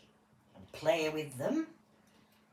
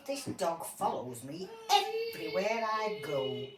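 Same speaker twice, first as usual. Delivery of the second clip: usual speaking voice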